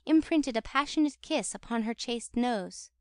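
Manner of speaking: plain reading voice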